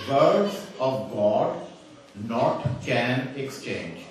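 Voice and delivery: very slow, word by word; slow deliberate misquote. Retold or imitated. Speaker revealing garbled revelation to audience, very slow and deliberate. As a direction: space out "not can exchange" for effect. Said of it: "get this one, brothers and sisters".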